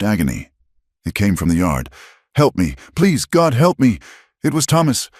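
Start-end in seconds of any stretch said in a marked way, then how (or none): 2.3-5.1 much higher, desperate cries; screaming for help. Acted. Tortured boy calling to the cabin, desperate high screams. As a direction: plead for your life.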